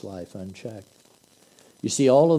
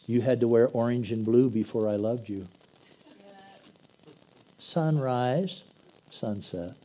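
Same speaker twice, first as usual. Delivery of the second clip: ordinary delivery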